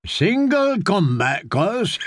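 slow, amused jab at his cousin; a slow grinning tease. A narrator's reading. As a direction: savour it slowly with a grin. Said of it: "Davin grinned through his bushy beard".

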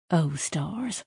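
whispered "Oh, stars" under the breath; muttered aside. Acted. Tak dreading Sidra's lie to the merchant, whispered under breath. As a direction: mutter it in dismay so only she hears. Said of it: "Tak muttered".

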